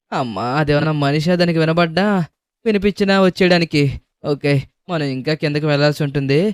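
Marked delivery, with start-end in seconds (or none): none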